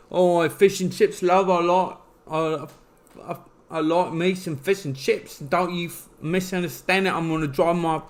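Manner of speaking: hammed-up Cockney impression; mock Cockney accent. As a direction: lay on a thick, garbled fake Cockney. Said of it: "we were talking about the Cockney accent".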